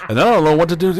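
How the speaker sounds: high, loud, out of air; breathless rush. Retold or imitated. Friend imitating Bill talking too fast to breathe, breathless. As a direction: gasp it out like he has no air left. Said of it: "no more air in his lungs".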